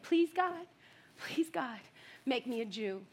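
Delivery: put-on hushed, fading childlike plea; soft pleading prayer. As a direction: beg God quietly like a small child.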